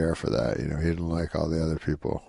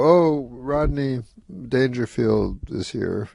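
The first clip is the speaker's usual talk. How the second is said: drawn-out, higher, slow announcer call; announcing a guest; 6 semitones higher, slower than usual. Retold or imitated. Lauren announcing that Rodney has arrived, slow and stretched. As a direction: stretch out the Oh like a host introducing a star. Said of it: "Lauren would just go".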